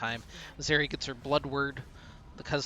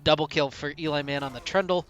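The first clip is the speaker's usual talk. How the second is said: upbeat, louder esports cast; play-by-play casting; 3 semitones higher, 7 dB louder than usual. Acted. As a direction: call the fight with rising energy. Excited play-by-play of a fight.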